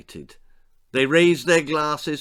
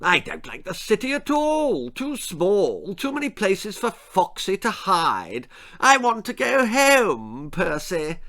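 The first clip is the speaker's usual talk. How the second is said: high, petulant plummy voice; posh whine; 7 semitones higher than usual. Acted. Lady Davinia whining to Percy about going home. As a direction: sulk about the city.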